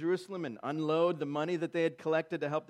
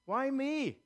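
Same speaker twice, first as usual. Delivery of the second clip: Why me with me hit high and hard; self-pitying cry leaning on me; stress on me; 10 semitones higher than usual. Acted. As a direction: lean all your weight on me in Why me. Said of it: "Emphasis on me there".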